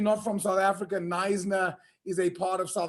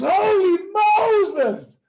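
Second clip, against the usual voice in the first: high, loud cry; desperate shout; 9 semitones higher, 7 dB louder, much slower than usual. Acted. Rider at the cliff edge shouting desperately. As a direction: yell it at the last second. Last second stop at cliff.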